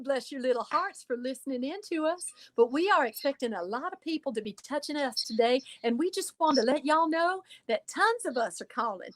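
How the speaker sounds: put-on over-the-top Southern belle accent, syrupy and high; Southern Georgia accent. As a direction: gush like a hospitable Georgia shop hostess with a Southern accent. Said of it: "that same Ann Taylor store in Southern Georgia".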